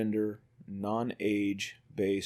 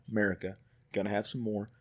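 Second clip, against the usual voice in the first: usual speaking voice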